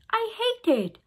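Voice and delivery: put-on girl shouting at her dad about the room; shouted. A narrator's reading. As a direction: yell it in a tantrum. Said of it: "I shouted".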